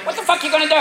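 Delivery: high-pitched cocky challenge; ballsy raccoon. Acted. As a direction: dare them like a small brazen raccoon. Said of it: "they look at you like".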